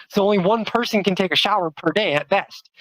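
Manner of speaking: usual speaking voice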